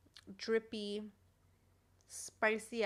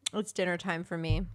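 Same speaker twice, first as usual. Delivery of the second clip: usual speaking voice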